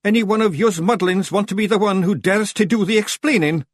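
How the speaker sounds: high, loud Scottish challenge; snapping Rob Anybody. A narrator's reading. Rob challenging the muddlings to explain, snappish. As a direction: dare them angrily to try it. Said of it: "snapped Rob Anybody".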